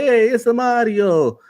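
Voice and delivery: high, drawn-out cartoon Italian accent; Mario. Podcaster mocking a film's missing Mario voice, mock Italian accent. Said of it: "he didn't do no Italian voice".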